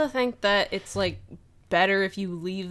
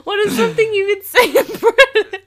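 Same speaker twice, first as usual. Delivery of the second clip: high, loud question through real laughter; barely getting it out for laughing; laughing hard; 12 semitones higher, 10 dB louder than usual. Real, not acted. Asking partner for British phrases, laughing hard. Both cracking up over accents.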